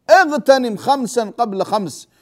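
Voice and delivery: slow, weighted اغتنم with every word stretched; a preacher dwelling on اغتنم خمسا قبل خمس; slow emphatic repeat. Shown as a demonstration. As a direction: repeat it slowly so it sinks in.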